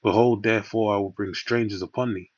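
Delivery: ordinary talk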